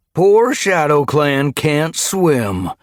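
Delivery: high, loud, drawn-out taunt; mocking. A narrator's reading. Guard mocking the trapped Shadow Clan cats, mocking. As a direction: stretch it into a gloating jeer. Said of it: "Gorseclaw had mocked".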